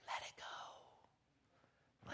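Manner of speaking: soft, slow whispered release. Acted. Minister easing the congregation into release, whispered slowly. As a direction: breathe the words out, letting go.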